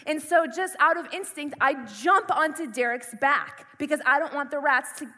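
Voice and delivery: normal talking voice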